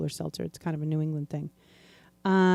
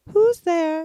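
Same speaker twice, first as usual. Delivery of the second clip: high, loud put-on impatience; irritated joke responder; 11 semitones higher, 7 dB louder than usual. Listener hearing the same knock again, mock irritated. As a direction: answer the knock high and testy. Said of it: "they're getting really irritated with you".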